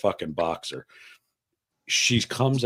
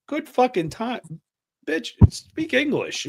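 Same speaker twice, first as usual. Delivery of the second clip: very high-pitched mock exasperation; hammed-up indignant tourist, pitched way up; exasperated retort. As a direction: squeal the comeback in disbelief. Exasperated reply to the solicitor.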